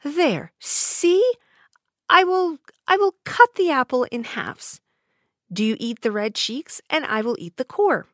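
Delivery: high, coaxing crone voice; old peddler woman. A narrator's reading. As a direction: persuade her with the apple. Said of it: "cried the old woman".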